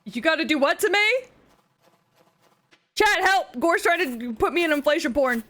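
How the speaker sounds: high, loud mock alarm; mock panic. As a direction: cry out to the chat in playful horror. Mock panic pleading to chat.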